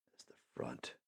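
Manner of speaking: plotting whisper. Retold or imitated. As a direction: whisper it so no one overhears. Plotting escape under his breath.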